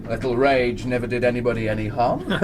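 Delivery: a chuckle running through the line; sly chaplain chuckling at his own wit; laughing joke. Acted. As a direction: laugh as you deliver the joke. Chaplain jokes while drinking with mutineers.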